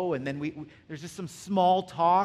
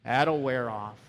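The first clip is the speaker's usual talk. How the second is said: put-on lower, weary knowing voice of old marrieds; mock the been-there couple, low and tired; jaded married couple; 7 semitones lower than usual. Married couple dismissing young love, low weary voice. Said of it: "people who've been married for thirty years goes".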